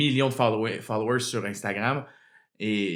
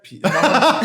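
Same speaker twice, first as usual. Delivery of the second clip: mock Québécois script; 3 semitones higher than usual. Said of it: "il fallait que je dise".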